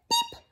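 tiny very high peep; short squeaky chick call; chick's peep. Acted. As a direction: cheep like a baby chick. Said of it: "her chick said".

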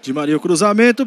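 ordinary delivery

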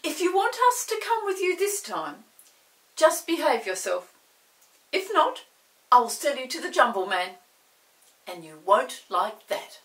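high, loud warning to the chair; stern threat. Acted. Chinky warning the chair about the Jumble Man, loud and stern. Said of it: "Chinky looked at it sternly".

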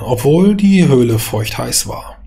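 ordinary delivery, nothing marked

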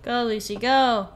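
lightly urging a runner on; mild cheer. Pretend. Cheering on a game character.

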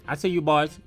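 ordinary talk, nothing marked

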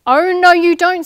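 loud, high warning; sharp stop. Acted. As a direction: stop the thief in his tracks. Stopping the thief on bus.

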